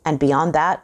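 ordinary delivery, nothing marked

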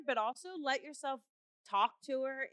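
ordinary delivery

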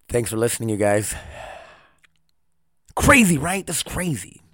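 usual speaking voice

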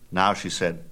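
ordinary delivery, nothing marked

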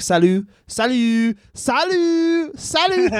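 high, drawn-out mock French greetings; stupid French accent. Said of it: "makes fun of their stupid accents".

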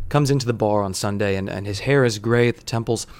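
plain reading voice

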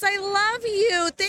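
slow, tearful, high-pitched thanks; choked up. Real, not acted. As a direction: thank them while fighting back tears. Streamer moved by a gift.

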